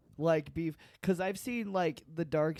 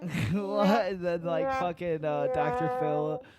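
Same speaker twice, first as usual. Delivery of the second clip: ordinary delivery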